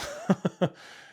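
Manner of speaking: real breathy chuckling; breathy laugh. Real, not acted. Storyteller recalling the miserable help desk, breathy laugh. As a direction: laugh it off for a moment.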